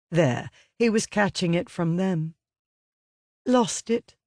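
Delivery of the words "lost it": apologetic. Retold or imitated. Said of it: "she had said apologetically".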